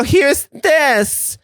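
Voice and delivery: high, stretched-out mock calling; family calling out. Family throwing a paddleboard to a struggling Joe, high mock calling. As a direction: call across the water in a high, slow put-on voice. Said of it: "They go".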